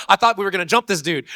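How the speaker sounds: high, loud complaint; indignant whine. Retold or imitated. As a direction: protest like a betrayed kid. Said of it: "I was like".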